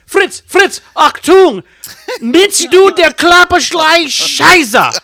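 high, loud, slow barked fake German; shouting orders at Fritz, mock German; mock German officer. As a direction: bellow orders like a war movie officer. Mock German war movie.

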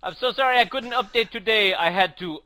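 loud, high mock-French apology; hammed-up French accent sliding into Dracula; bad French accent. French cartoonist apologizing for the missed update, bad French accent. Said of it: "at what point does my French accent turn into Count Dracula?".

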